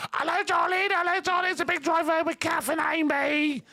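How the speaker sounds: very high, sing-song mocking voice; mocking impression. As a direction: send up a gushing presenter, high. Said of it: "taking the mickey out of people".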